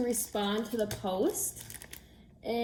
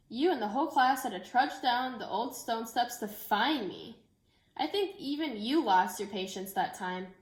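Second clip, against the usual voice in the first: gentle, expressive picture-book read-aloud; reading to children. Acted. Teacher reading a book to her class, storytelling voice. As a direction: tell the story so the children follow every page.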